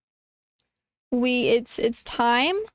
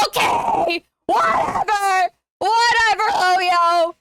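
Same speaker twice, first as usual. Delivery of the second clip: real yelling; 3 semitones higher than usual. Real, not acted. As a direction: howl in frustration. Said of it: "rip my vocal cords".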